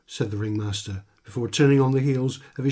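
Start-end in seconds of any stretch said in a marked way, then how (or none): none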